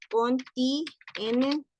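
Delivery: "contienen" drawn out very slowly in pieces; syllable-by-syllable dictation. Real, not acted. Teacher saying it as she writes, slow syllabled dictation. Dictating while writing on screen.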